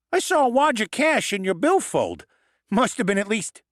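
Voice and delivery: the goblin blurting helpfully. Acted. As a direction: goblin Lennox giving away the cash, squeaky. Goblin Lennox voiced in story.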